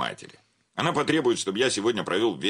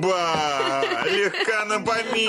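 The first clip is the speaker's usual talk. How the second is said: feigned surprise; 13 semitones higher, slower than usual. Acted. Banker greets his wife with forced high cheer. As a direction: put on a bright surprised welcome.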